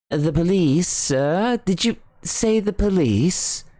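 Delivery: a servant who can't believe it; astonished butler. Acted. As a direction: butler startled at the mention of police. Parker astonished.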